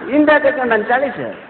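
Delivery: ordinary delivery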